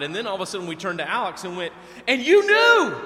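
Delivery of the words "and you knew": very high, loud squealed accusation; accusing squeal. Retold or imitated. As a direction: squeal it at your friend like you caught them. Friends accusing Alex excitedly.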